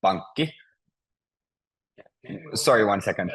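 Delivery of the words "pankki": PANK-ki, stressed at the start; first-syllable stress. Shown as a demonstration. Teacher repeats Finnish word for bank, stress on first syllable. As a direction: put the weight on PANK in pankki. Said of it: "the first is always stressed".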